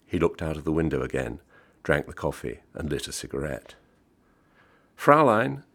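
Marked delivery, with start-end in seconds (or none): 5.0-5.6 raised, higher call for attention. A narrator's reading. Customer to the waitress in the station cafe, calling out. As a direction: call across the cafe for service. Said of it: "he called".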